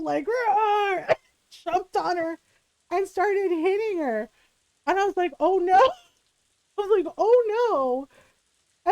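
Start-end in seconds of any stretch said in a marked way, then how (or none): 0.0-1.1 mock growl. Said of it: "weird growl". 5.4-8.9 higher and louder, dismayed; realizing what she did, aghast; horrified oh no. Retold or imitated. Remembering the fight, she gasps in dismay.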